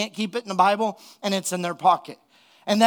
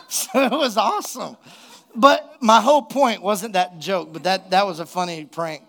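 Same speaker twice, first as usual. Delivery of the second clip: usual speaking voice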